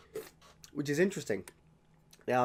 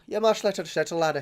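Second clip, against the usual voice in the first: higher, playful Scottish brogue; mock Scottish accent; 5 semitones higher, 5 dB louder than usual. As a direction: repeat it as a hammed-up Scot.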